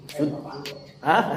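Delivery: normal talking voice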